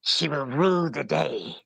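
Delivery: slow, low sinister line; hissy evil woman. Shown as a demonstration. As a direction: hiss it like a villainess. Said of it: "I want you to say".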